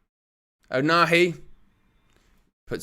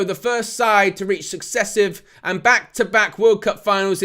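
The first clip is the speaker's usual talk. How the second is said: raised, ringing commentary pitch; full-time announcement; 4 semitones higher than usual. Real, not acted. Commentator announcing France reach successive finals, excited. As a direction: proclaim the result with excitement.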